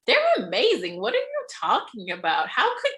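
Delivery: high, loud imitation of indignant defenders; mock disbelief. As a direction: act out colleagues who can't believe it. Mimicking everyone defending the abuser.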